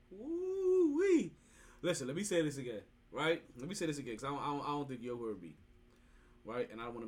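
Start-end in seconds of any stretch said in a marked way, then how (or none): 0.1-1.3 excited whoop. Real, not acted. Excited exclamation about December first.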